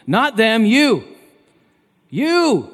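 high, loud, punched words; forceful rebuke. Acted. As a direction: turn it sharply on the listeners. God's rebuke turned on the listeners.

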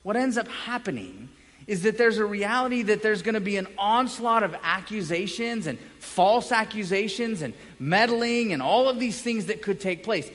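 ordinary talk, nothing marked

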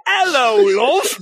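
high, mock British "Hello, love"; British accent. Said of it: "read it in a British accent".

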